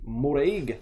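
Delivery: the name Moreg in a lightly put-on Scottish accent. Reading the card name aloud to viewers in a mock Scottish accent. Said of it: "my Scottish accent".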